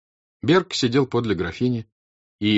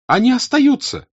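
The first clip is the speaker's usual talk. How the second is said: very high, loud, outraged cry; Natasha shouting; 9 dB louder than usual. Acted. Natasha bursting in on her mother, shouting. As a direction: hurl the words, outraged. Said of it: "закричала она".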